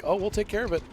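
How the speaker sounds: insurance agent. Retold or imitated. Insurance agent reassuring caller.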